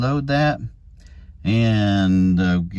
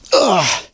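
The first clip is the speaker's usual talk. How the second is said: loud high grunt of effort; 8 semitones higher, 5 dB louder than usual. Real, not acted. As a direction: grunt as the knees hit the ground. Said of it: "getting too old for this stuff".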